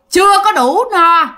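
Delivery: put-on the spirit in the boy demands another chicken, booming; booming ghost voice. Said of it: "Mà cái giọng ồ ồ rất là ghê". (giọng ồ ồ ghê rợn, to và cao)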